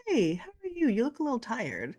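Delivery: high, solicitous greeting; concerned check-in. Retold or imitated. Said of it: "somebody actually looks at me and goes".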